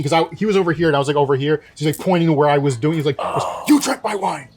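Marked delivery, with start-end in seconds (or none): 3.7-4.5 higher-pitched mock accusation; irate customer. Furious diner to the sommelier over his glass, imitated outrage. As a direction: act out the diner's indignant outburst.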